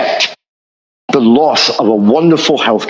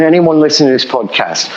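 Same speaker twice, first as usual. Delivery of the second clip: normal talking voice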